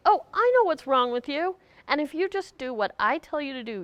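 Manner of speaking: a fixer cheerfully taking charge; knowing rescuer. Retold or imitated. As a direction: play the pushy helper who has all the answers. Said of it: "it's called a rescuer".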